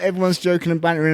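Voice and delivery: ordinary talk, nothing marked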